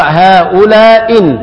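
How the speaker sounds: slow هؤلاء إن with the first hamza dropped. Shown as a demonstration. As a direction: drop the end hamza of هؤلاء, stretch it. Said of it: "يقرأ".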